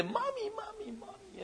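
very high, soft whining mommy mommy; mock toddler whine, hammed up; whiny child voice. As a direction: whimper it like a crying toddler. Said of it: "you run home crying, say".

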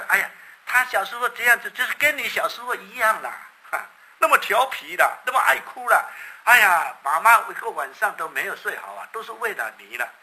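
high, loud fond complaining; exclaiming grandmother. Acted. Grandmother loudly telling the new parent how naughty he was. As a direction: gush about the old days with exasperation.

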